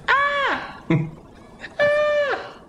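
piercing high animal squeal; sloth screech. Acted. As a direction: shriek like a silly sloth. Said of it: "Oh, that was it".

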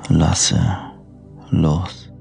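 soft, slow sleep meditation voice; guided meditation. Acted. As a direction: lull the listener toward sleep gently. Freigesprochene Einschlafmeditation.

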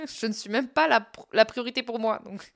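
amused, incredulous self-mockery; laughing at oneself. Real, not acted. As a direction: let giggles break up the confession. Laughs at own slip about priorities.

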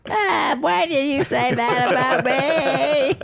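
high slow hammed-up sob; mock crying whine. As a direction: whimper it like a wounded baby. Voicing boiling baby calling in.

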